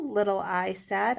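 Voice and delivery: ordinary delivery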